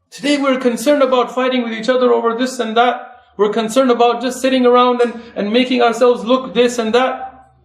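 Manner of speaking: rising, driving sermon voice; impassioned preaching. Acted. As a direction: rouse the congregation to action. Preaching call to da'wah.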